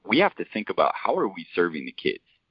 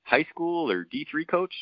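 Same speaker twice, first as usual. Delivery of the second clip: put-on needling old coach; 10 semitones higher than usual. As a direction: play the seen-it-all veteran poking at the young coach. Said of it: "And he's like".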